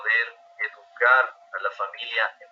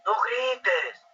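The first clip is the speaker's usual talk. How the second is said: high mock yell at a child; imitating a parent shouting no; 10 semitones higher than usual. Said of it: "la mamá o el papá le grita". (padre que le grita al niño, grito imitado y agudo)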